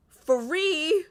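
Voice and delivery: high gleeful cry of free; excited squeal. Real, not acted. Streamer cheering a free card, high and gleeful. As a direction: squeal it with delight.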